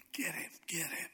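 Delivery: whispered urging, hushed; urgent whisper. Acted. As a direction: whisper it like soldiers egging him on. Soldiers secretly urging David.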